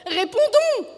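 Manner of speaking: high, loud exasperated wife; shrieking exasperation; exasperated cry. A narrator's reading. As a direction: shout it high, demanding an answer. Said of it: "s'écria exaspérée".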